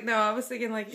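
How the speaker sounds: usual speaking voice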